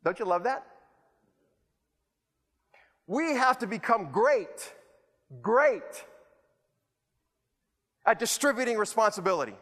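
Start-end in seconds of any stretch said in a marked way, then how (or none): none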